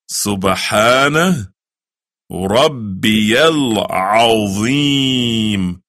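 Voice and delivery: slow, drawn-out Arabic recitation. Shown as a demonstration. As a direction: stretch every syllable of the prayer. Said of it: "you say".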